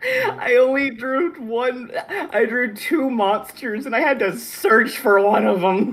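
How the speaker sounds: high, slow laughing whine; laugh-crying. Real, not acted. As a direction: complain through laughter. Laughing complaint over bad hand.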